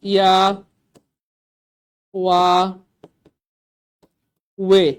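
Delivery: ইয়া, ওয়া, ওয়ে each sounded alone; ইয়া as "ya", ওয়া as "wa", ওয়ে as "we", spaced out; sound demonstration. Shown as a demonstration. Teacher dictating four sounds, ইয়া, ওয়া, ওয়ে isolated. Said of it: "চারটা উচ্চারণ আছে".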